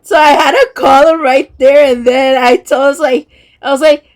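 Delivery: high, loud, near-crying laughter; can barely get the words out for laughing; laughing hard. Real, not acted. Retelling brother's socks mix-up to viewers, helpless high laughter. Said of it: "I started laughing at him so hard".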